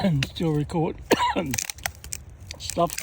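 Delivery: coughing through a mouthful of dust. Real, not acted. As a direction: talk with grit in your mouth, coughing. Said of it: "dust in my mouth".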